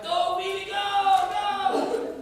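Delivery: high, loud shout to a runner; retold cheering. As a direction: cheer from the stands, stretching each GO. Retold cheering for his child running.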